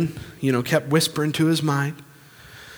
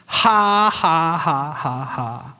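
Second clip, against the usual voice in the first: put-on laugh; much slower than usual. Preacher imitates man laughing on purpose at devil, put-on laugh. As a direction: force each laugh out deliberately. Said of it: "I just put it on".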